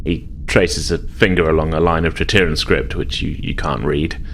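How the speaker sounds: ordinary delivery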